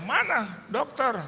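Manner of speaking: high, drawn-out demand for someone; acted-out panicked father calling out; frantic demand. Panicked father banging on doors to find the doctor, demanding. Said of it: "akhirnya saya gedor, saya tanya".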